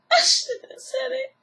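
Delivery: high, breaking sob; crying. Retold or imitated. Erica Pinky regretting her words on the show, sobbing. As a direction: say it through tears.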